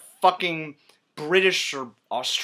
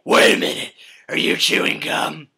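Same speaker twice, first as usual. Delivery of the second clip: garbled growl; 6 dB louder than usual. Acted. Batman catching the Joker chewing gum, growling. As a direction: growl the question hoarsely.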